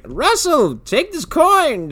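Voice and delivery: high-pitched, drawn-out call; urgent old grandpa. Retold or imitated. Grandpa handing Russell the coin, high urgent call. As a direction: call out high and slow, urging him.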